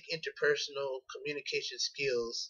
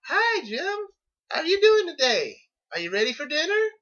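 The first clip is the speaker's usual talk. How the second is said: put-on much higher, louder singsong greeting to a child; high-pitched talk to a child; 10 dB louder than usual. Shown as a demonstration. Student showing how adults talk to children, in a high singsong. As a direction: lift the voice way up like greeting a little kid. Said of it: "adults have a tendency to speak with a higher pitch".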